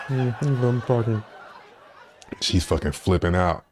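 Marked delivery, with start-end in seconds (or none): 0.1-1.2 higher, mock-innocent with a laugh; playing dumb sing-song; feigned innocence. Ignoring the angry woman, mock innocent and higher.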